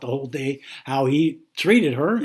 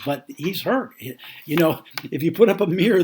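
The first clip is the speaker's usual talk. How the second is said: ordinary delivery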